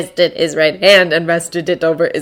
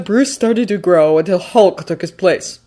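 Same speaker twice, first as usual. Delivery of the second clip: plain reading voice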